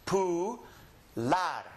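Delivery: Pu-lar split, U as in "poo"; syllable by syllable. Shown as a demonstration. Teacher breaks popular into syllables, Pu-lar said as poo, lar. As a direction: separate Pu-lar with a pause between. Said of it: "This is an U".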